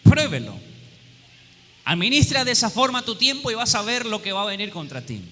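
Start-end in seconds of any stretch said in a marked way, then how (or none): 0.0-0.6 higher, much louder repeat of pruébelo; loud challenge. Real, not acted. Preacher challenging doubters to test it, loud dare. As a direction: punch pruébelo as a dare to the congregation. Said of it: "Y si no me cree".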